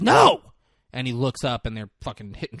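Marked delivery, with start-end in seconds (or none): 0.0-0.4 high, loud imitated cry; urgent mock shout. Driver to distracted cop as traffic looms, urgent mock shout. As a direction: cut him off with a hammed-up yell.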